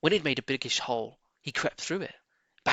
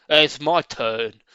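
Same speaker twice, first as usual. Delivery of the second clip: louder, slow eager badger; 2 semitones higher, 8 dB louder, much slower than usual. A narrator's reading. Badger claiming his turn. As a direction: grab the jar, eager.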